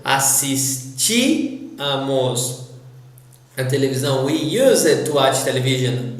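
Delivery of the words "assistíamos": slow "Assistíamos", split after a long "tí"; stressed accented í. Shown as a demonstration. Teacher demonstrates the rule on "Assistíamos", slow stressed í. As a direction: hold the "tí" in "Assistíamos", then drop to "amos". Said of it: "we need to stress the".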